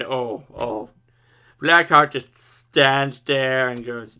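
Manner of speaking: usual speaking voice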